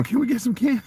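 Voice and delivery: put-on high little-kid voice pleading for candy; begging child. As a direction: ask like a hopeful small kid. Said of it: "We sitting there going".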